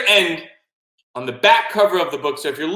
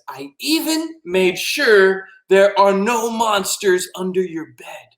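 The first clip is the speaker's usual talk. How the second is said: reassuring; 6 semitones higher, slower than usual. Acted. Octopus reassuring about monsters.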